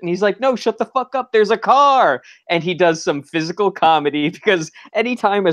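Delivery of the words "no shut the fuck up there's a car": mock irritated. Acted snap at family.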